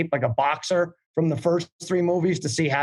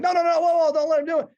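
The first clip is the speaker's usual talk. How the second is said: panicked high yell to stop him; alarmed shout; 13 semitones higher, faster than usual. Retold or imitated. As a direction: shout a sudden warning, voice jumping way up. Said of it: "Jeff is all, all of a sudden like".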